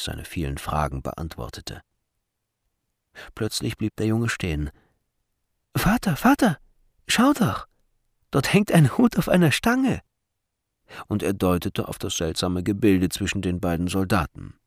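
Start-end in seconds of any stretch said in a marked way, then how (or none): 5.7-10.0 put-on high-pitched child voice; high, eager kid pointing something out; excited little boy. A narrator's reading. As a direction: call out to father like a curious boy. Small boy calls out to father.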